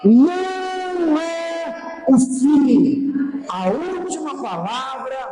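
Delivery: high, stretched preacher's declarations; high-pitched proclamation with falling cadences; impassioned preaching. Real, not acted. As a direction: declare it high, drawing out the endings.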